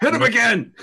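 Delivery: high, loud eager shout; excited shout. Acted. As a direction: cheer them on to strike. Urging attack, repeated.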